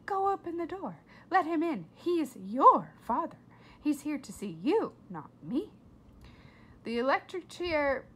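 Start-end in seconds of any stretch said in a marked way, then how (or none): 0.0-5.7 louder, pushy mother; annoyed mother sends Flora to the door; impatient mother. A narrator's reading. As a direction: order her to the door. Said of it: "Flora's mother".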